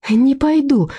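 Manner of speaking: визг. A narrator's reading. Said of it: "завизжала Людмила". (визгливо, выше и громче)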